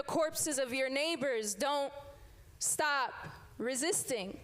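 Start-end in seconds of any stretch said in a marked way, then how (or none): none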